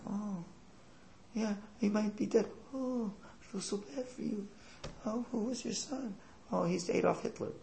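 very high, soft, pitying voice; mock tearful sympathy. Passer-by and Hitler's mother share grief, mock tearful softness. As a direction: coo with exaggerated sorrow, high and soft. Acted exchange with grieving mother.

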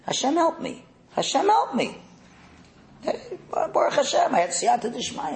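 high-pitched put-on voice; high, devout little act; pious son in disguise. Voicing disguised Yaakov's pious answer.

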